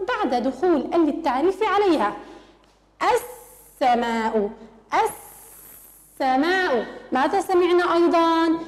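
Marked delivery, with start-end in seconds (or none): 3.0-4.5 السماء very slow and lower, lam silent, سّ doubled; stretched السماء with doubled s; slow sun-letter demo. Shown as a demonstration. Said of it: "لم نسمع بلام". 4.9-6.9 السماء very slow, lam silent, سّ doubled; stretched السماء with doubled s; slow sun-letter demo. Shown as a demonstration. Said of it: "لم نسمع بلام".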